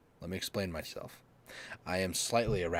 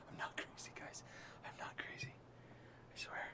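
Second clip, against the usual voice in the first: soft unvoiced whisper; 16 dB quieter than usual. Real, not acted. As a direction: whisper to viewers. Whispering to viewers.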